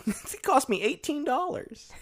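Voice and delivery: put-on high, slow little kid voice. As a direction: talk like a small child. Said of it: "your little kid voice".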